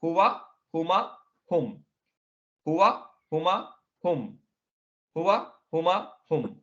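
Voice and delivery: slow rote recitation of हुआ, हुमा, हुम; repeating drill. Shown as a demonstration. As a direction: drill the pronouns for the class. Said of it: "क्या फिर से हम repeat करेंगे".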